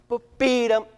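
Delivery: Ba-be-dum with punched accents; accented scat. Shown as a demonstration. Director demonstrates leaning into accents. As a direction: lean into each accent. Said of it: "lean into those accents".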